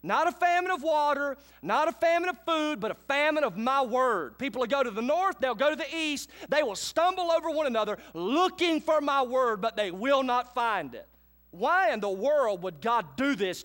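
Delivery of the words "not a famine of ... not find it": prophet Amos. Acted. Amos shouting God's warning to Israel, loud and high. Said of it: "Amos speaking".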